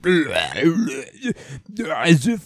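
slow, strange distorted demon voice; lightly voiced warped villain; distorted Dante. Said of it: "distort and just go like".

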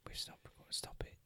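hushed whisper after signing off. Pretend. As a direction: whisper like the recording stopped.

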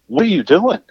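high, incredulous demand; retelling a confrontation, indignant; exasperated challenge. Retold or imitated. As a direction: call him out, fed up. Confronting the interrupting man.

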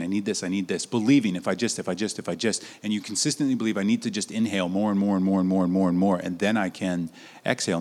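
low, soft run of repeated pleas; needy inner monologue. Acted. Voicing a person who only ever inhales, needy muttering.

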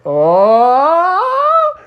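long "oh" sliding up high; high, drawn-out whoa; rising cry of amazement. Acted. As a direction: let the cry climb as if lifted skyward. Froggy lifted high into the air.

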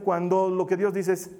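usual speaking voice

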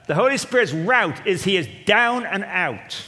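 usual speaking voice

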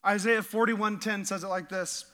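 ordinary talk, nothing marked

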